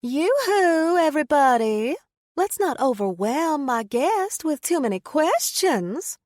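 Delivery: loud, high Southern belle calling out to the crowd; southern accent. A narrator's reading. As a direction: sing out over the crowd in a big Southern drawl. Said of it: "emphasizing her southern accent".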